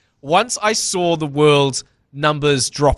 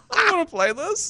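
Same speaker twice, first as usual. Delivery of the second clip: laughing whine; 14 semitones higher, 4 dB quieter than usual. Retold or imitated. Retold whiny quote with laugh.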